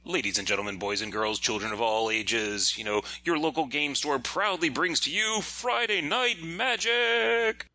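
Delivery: big booming ring announcer hype; rising to a high, grand finish. Pretend. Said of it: "do your best ring announcer voice".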